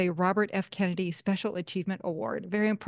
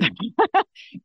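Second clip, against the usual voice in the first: short amused laugh; light, spontaneous laugh; 2 semitones higher, 6 dB louder than usual. Real, not acted. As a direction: burst out in a quick chuckle. Host laughs at covid time remark.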